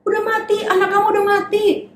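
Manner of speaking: high, sneering mock voice; scoffing crowd. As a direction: jeer at the parents. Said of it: "orang-orang bilang".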